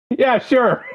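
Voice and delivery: high, sarcastic disbelief. Retold or imitated. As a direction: scoff it, high and mocking. Mocking reply to quoted claim.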